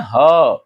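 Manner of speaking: ह as a lone breath-led "ha"; visarga sound. Shown as a demonstration. Teacher answering how visarga is pronounced ह, visarga sound. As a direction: sound ह by itself as a short syllable "ha". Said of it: "इसका उच्चारण हम कैसे करते हैं".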